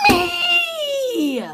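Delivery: high, loud squealed "Me!"; eager squeal. Retold or imitated. Imitating Elle Woods squealing to claim the last spot. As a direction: squeal it like you can't wait to be picked.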